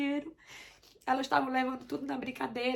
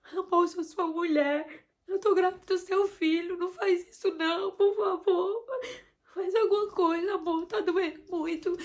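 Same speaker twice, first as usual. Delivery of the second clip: retold begging, higher and louder with a catch of tears and nervous laughs; desperate begging; 5 semitones higher, 5 dB louder than usual. Wife in labour begging husband to get her out, tearful. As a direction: beg him for help as if in pain. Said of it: "ele me viu realmente desesperada".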